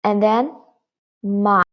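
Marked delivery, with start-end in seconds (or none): 1.2-1.6 long MA that dips and comes up; dipping tone. Shown as a demonstration. Teacher showing third tone, ma down then up. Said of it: "the third tone".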